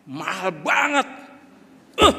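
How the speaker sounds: seru kaget lebih keras; kaget mahal. Retold or imitated. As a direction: terkejut lihat bon. Kaget lihat tagihan. (kaget membaca tagihan restoran)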